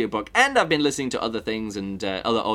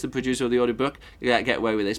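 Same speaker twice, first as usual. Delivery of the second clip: usual speaking voice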